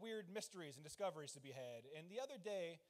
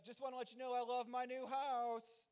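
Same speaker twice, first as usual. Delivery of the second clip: higher, cutesy gushing; sing-out happy call, pitched up; mock elated; 6 semitones higher than usual. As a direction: imitate a wife gushing across the house. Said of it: "all that elated bullshit".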